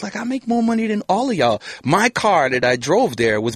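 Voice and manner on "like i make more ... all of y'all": mock boast. Retold snub of neighbors.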